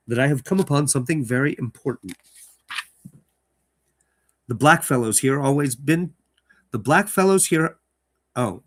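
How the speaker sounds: story narration. Acted. Host reading the letter in the story aloud, dramatic narration. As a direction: open the letter with weight.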